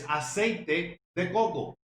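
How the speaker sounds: "aceite de coco" said slowly and clearly in Spanish; slow Spanish demo. Shown as a demonstration. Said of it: "coconut oil is".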